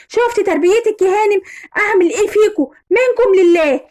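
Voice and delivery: high-pitched exasperated reproach; angry scolding. Acted. Husband blames his wife for the son's upbringing, exasperated scolding.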